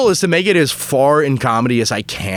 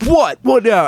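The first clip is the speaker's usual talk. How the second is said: high-pitched challenge to fight; mock confrontation; 9 semitones higher than usual. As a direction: imitate a riled-up cyclist squaring off. Acted confrontation at red light.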